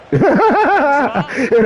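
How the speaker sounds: high laugh breaking into the words; slowly laughed out. Real, not acted. Laughing at the memory.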